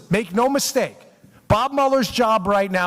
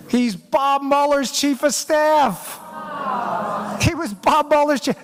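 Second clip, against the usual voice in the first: higher, slow and weighty, each word landed; slow dramatic reveal; 6 semitones higher, slower than usual. Real, not acted. Host revealing the key link to listeners, slow and emphatic. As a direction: lay out the big reveal slowly.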